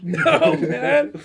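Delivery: high, loud drawn-out plea breaking into laughter; mock yell. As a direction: cry out to stop him, laughing. Acted cry while tackling Bruce.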